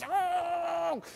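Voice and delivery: long, very high mock cry of rage; angry leprechaun growl. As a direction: squeal like a furious little leprechaun. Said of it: "looked like an angry little leprechaun".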